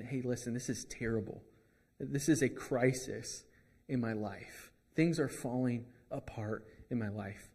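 quiet, defeated plea; a king murmuring that everything is lost; despairing king. Retold or imitated. A king quietly confessing his crisis, softly despairing. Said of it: "The king is saying".

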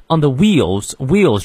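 "wheels" strong after a light "on the"; stress on WHEELS. Shown as a demonstration. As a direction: lean on "wheels". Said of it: "wheels重读". (“wheels”重读)

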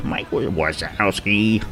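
slow, put-on Wazowski, badly faked; terrible accent. Shown as a demonstration. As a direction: drag out "Wazowski" in a mock foreign accent. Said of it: "That's a terrible accent".

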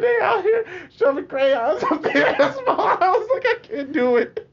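half-crying with laughter; laughing complaint. Retold or imitated. Telling his mom about the crayon, laughing in disbelief.

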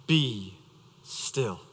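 fading to a near whisper, low and soft; hushed command. Acted. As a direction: let it sink and die away into stillness.

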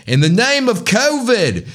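put-on accidental British-sounding accent; botched Australian accent. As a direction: fake Aussie that slips into British. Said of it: "that sounded more British".

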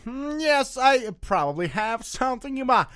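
hammed-up Texan doctor. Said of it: "I'm in Texas".

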